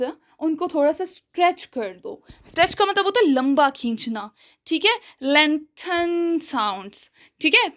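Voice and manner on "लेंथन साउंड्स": "लेंथन साउंड्स" with long drawn-out vowels, very slow; stretched vowels. Shown as a demonstration. Teacher demonstrating stretched vowels in "लेंथन साउंड्स". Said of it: "उसे लंबा खींचने वाली बात है".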